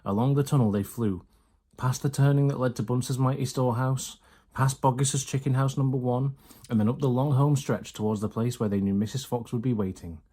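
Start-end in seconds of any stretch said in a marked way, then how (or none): none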